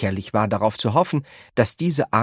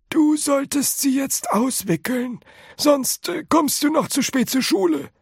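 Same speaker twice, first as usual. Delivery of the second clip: put-on high old man, brushing it off; old grandpa voice. A narrator's reading. Grandpa Joe tells Charlie to forget the tickets, high old voice. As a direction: wave it away in a high grandpa voice.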